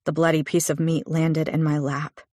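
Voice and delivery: usual speaking voice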